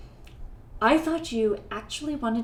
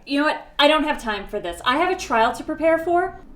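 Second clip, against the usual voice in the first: louder, exasperated brush-off; fed up; 5 semitones higher, 7 dB louder than usual. Acted. Lawyer witch with a trial to prepare, fed up. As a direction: cut the visitor off, out of patience. Witch losing patience with guest.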